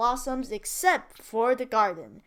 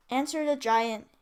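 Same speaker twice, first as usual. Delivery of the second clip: ordinary delivery, nothing marked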